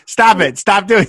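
mock exasperation, pushing someone off; irritated. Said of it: "he's just getting so irritated".

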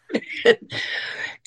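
high, breathless giggle rising up; high airy laugh of happy disbelief. Real, not acted. Laughing at getting the part.

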